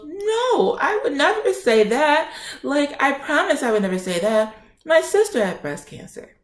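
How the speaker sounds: very high mimicked denial; protesting nurse imitation. Nurse denying she dismissed the patient, high-pitched protest.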